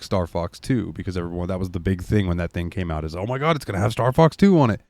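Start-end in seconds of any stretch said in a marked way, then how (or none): 3.1-4.8 mildly hammed-up fan excitement; quicker, higher excited crowd reaction; imitated hype. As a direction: lightly mimic everyone's excitement. Voices everyone's hype at the announcement.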